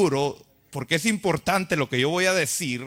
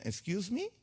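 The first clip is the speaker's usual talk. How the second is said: mock child's English reply; 6 semitones higher, 7 dB quieter than usual.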